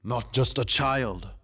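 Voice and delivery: slow, weighty king's answer; measured regal correction; Tiger King. A narrator's reading. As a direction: answer slowly as a proud king. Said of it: "the Tiger King answered".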